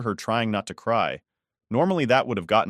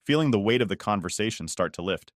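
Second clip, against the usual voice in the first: ordinary delivery, nothing marked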